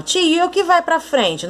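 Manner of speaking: pupil's question. Acted. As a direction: raise the pitch a little like a pupil piping up. Teacher voices a pupil's question.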